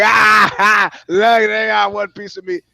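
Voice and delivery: loud, high, thrilled outburst; excited mock shout. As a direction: show off the find, hamming it up. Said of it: "And I'm like".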